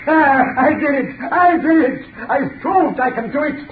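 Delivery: manic triumph. Acted. Mad doctor exults.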